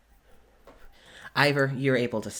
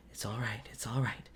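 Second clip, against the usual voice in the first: soothing hush; 8 dB quieter, faster than usual. Acted. Larkin calming sobbing Wallace.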